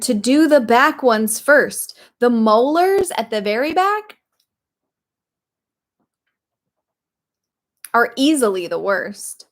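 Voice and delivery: lightly voiced bossy crocodile; declaring crocodile. A narrator's reading. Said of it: "Crocodile declared".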